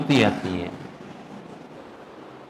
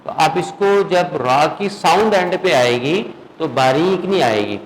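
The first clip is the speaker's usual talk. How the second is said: ordinary delivery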